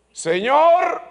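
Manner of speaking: loud, high cry; crying out to God. Retold or imitated. Preacher reliving crying out to the Lord, loud and high. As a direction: call out to God in anguish. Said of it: "clamé a Dios".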